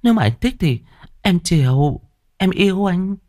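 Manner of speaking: high-pitched woman's voice, coaxing; coquettish female lover. Acted. Woman urging her lover to hurry home, coquettish and coaxing. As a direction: pitch it high and wheedle like a girlfriend.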